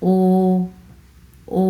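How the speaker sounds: ও held with both lips rounded; rounded vowel. Shown as a demonstration. Teacher showing learners how to round the lips, rounded vowel. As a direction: round the lips fully and sustain ও. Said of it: "ও এর মতো হচ্ছে গোল করে".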